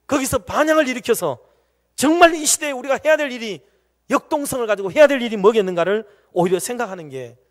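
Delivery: loud, high, driving sermon delivery; full-force preaching. Acted. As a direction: press the point home to the congregation.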